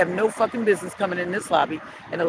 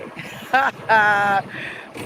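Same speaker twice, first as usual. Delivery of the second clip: laugh; 3 semitones higher than usual. Real, not acted. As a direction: laugh at your own remark. Laughs at own remark about baiting.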